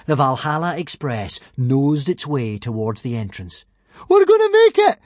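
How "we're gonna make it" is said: high, loud excited yell. Acted. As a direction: shout it with hope. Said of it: "yelled Fishlegs".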